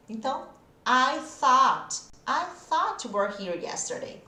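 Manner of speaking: slow careful I thought, tongue between teeth. Shown as a demonstration. Teacher models I thought for the class, slow and careful. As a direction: model thought in a sentence, slowly. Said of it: "faça o som você".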